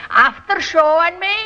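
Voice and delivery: Irish "after" with a TH after the T; Irish brogue. Shown as a demonstration. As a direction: coach demonstrating Irish "after". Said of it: "We say A F T H E R".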